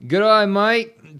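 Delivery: loud, high, drawn-out Aussie greeting; mock Australian accent. Streamer greeting a viewer in mock Australian accent. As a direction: call out a hammed-up Australian "g'day, mate". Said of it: "doing an Australian accent".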